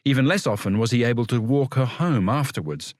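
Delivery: plain reading voice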